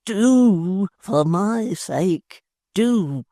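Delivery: very slow, syrupy lover's plea; mock tender wooing. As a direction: melt her with sweet talk. Tappertit woos her.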